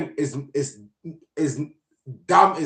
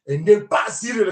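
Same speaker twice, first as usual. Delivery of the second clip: usual speaking voice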